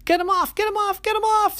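very high, loud, fast panicked squeal; panicked eggplant. Acted. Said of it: "said Eggplant".

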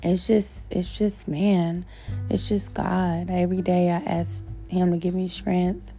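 ordinary talk

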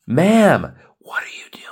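onhoorbaar gefluisterd, ongelovig; whispered disbelief. Retold or imitated. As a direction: fluister het vol ongeloof. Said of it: "looking at them from afar like".

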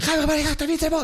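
high mock panic of the crew on set; frantic production assistant. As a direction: imitate crew rushing everyone. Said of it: "ברגע שמגיעות העוזרות הפקה".

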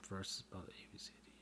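quick unvoiced whisper; whispered aside. Real, not acted. Vlogger whispering a quick aside to viewers. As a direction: hush it, fast, like a secret.

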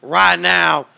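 loud ranting radio-host voice; Alex Jones impression. Doing Alex Jones for a friend, loud radio ranting. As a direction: announce it like breaking conspiracy news. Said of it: "Was that a good Alex or not".

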